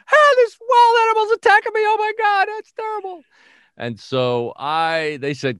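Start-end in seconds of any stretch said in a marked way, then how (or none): 0.1-3.2 hammed-up, very high-pitched panicked squeal; mock a little girl screaming for help; loud, sky-high falsetto shriek, played for laughs. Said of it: "screaming like a little girl".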